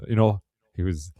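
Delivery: usual speaking voice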